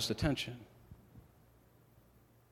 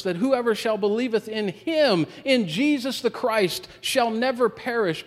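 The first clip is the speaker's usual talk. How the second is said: preaching at full force; 8 semitones higher, 4 dB louder than usual. Acted. Preacher proclaims the promise.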